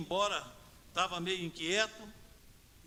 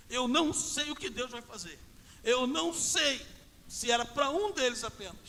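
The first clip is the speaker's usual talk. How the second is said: high, loud, emphatic declaration; impassioned preaching; 6 semitones higher, 7 dB louder than usual. Real, not acted. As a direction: drive each phrase out with conviction. Preaches at full force.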